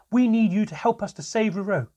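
loud, high-pitched angry yell; child's enraged outburst at a parent; furious shouting. Acted. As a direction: shout it like a blow landing. Child attacking father, shouting.

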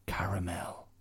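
soft unvoiced whisper. Bear whispering his name as he retreats. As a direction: breathe the name away. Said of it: "whispered the bear".

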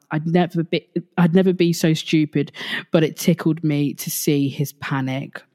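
normal talking voice

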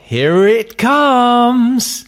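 high, slow, dazed voice of a returned spaceman; returned astronaut. Acted. Astronaut home from space, to his wife, high and slowed down. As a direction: play a spaceman who is high and slowed right down, lost back on Earth. Tim floats the salt.

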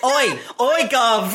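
hammed-up Cockney oi; Cockney accent. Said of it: "We Cockneys talk to each other".

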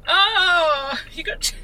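crying through the words; real crying. Real, not acted. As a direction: passenger in tears, answering the driver about chickens. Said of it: "Why is it so upsetting".

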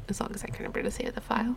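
quick hushed aside; fast whisper. Shown as a demonstration. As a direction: rush it out under your breath. Same line said again, whispered.

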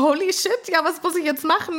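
high-pitched mock panic; alarmed Harry. Harry realises what is at stake, high mock panic. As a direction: blurt it out in retold alarm. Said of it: "Und Harry dann so".

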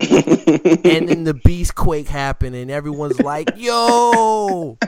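loud, slow, building to a big yell; excited. Retold or imitated. Describing the Beast Quake run, loud building excitement. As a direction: build up to the shout.

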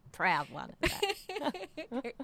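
laughing, proud and high; proud laugh, slowed down. Real, not acted. Proudly jokes about trucking.

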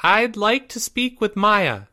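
put-on high, careful kid voice; brave young boy. A narrator's reading. Boy at girl's door, high and brave. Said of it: "said Timmy bravely".

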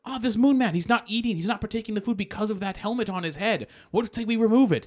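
high-pitched theatrical nobleman; Baron Munchausen. Retold or imitated. Acting Baron Munchausen at dinner.

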